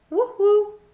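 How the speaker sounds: high loud hooting call; eerie high woo-hoo; owl hoot. A narrator's reading. Strange owl hoot again.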